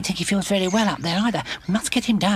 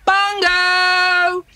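loud, higher call across the common; 8 semitones higher, 5 dB louder than usual. Acted. Wellington searching for his friend Bungo, calling out loud. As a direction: shout his name to find him.

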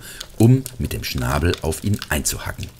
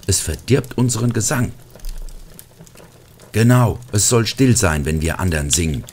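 higher, louder complaining ornament voice; a touch of indignant calling out; mild mock outcry; 5 semitones higher, 5 dB louder than usual. A narrator's reading. Straw goat and gingerbread man ganging up on the pig, indignant. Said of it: "rief der Strohbock".

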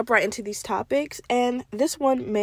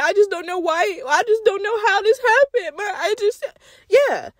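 mock clueless parent; 8 semitones higher than usual. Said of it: "you're gonna be like".

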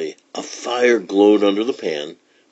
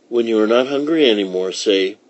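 ordinary delivery

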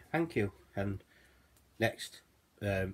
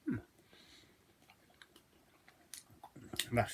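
chewing while talking; muffled through food; mouth full of bread; 3 semitones higher, 8 dB quieter than usual. Real, not acted. Seen 2: Bites naan at -3, cheeks full chewing through 3.0.

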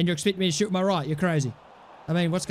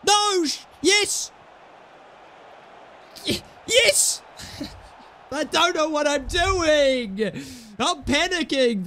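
panicked; 7 semitones higher, slower than usual. Real, not acted. Panicking with the ball.

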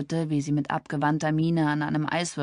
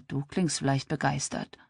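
ironic murmur; 2 semitones lower, 4 dB quieter than usual. A narrator's reading. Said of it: "murmelte sie ironisch".

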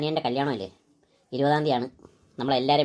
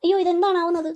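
panicked cry; 11 semitones higher than usual. Acted. Mother panics after the call.

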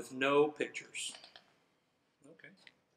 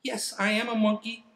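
lightly voiced, insistent monkey; 6 semitones higher, 3 dB louder than usual. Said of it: "my monkey voice".

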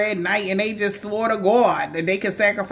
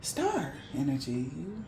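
soft and quiet; 11 dB quieter than usual. Real, not acted. Soft closing reflection.

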